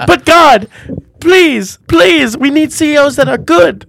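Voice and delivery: loud, desperate prayer down a bad line; shouting into a phone. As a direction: yell your plea to God like the signal's bad.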